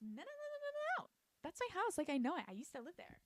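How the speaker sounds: very high, squeaky mock old lady; insistent old lady. Old lady insisting to Nina, high old-lady voice.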